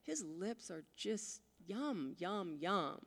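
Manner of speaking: slow, soft, lovestruck murmur; dreamy swoon. Retold or imitated. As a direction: savour each yum like a treat. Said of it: "I'm like".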